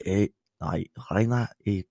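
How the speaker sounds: muttered, halting Scottish impression; mumbled Scottish accent. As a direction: mumble it like a badly faked Scot. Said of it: "Scottish like this".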